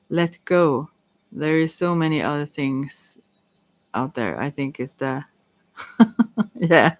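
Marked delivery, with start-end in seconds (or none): 6.0-7.0 laughing. Real, not acted. Card reader cracks up mid-reading, laughing. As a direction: break up laughing around the word. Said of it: "sorry for laughing also".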